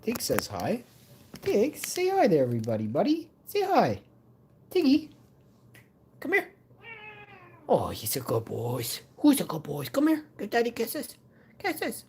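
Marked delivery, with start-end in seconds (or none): 1.4-6.5 high sing-song pet voice. Real, not acted. Talking to his cat. 9.2-12.0 very high cooing pet talk. Real, not acted. Cooing to his cat.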